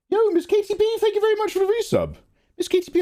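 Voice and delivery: very high squeaky put-on voice; squeaky thanks. Streamer thanks Miss Katie B for resub, squeaky high voice.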